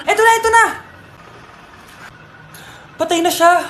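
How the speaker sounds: normal talking voice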